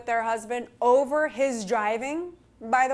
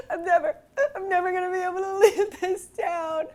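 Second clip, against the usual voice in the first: pitched up, half-laughing through tears; retold crying plea; 7 semitones higher than usual. To husband about the scraped car, laughing through tears. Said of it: "I was crying and I said".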